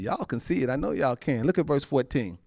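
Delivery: ordinary talk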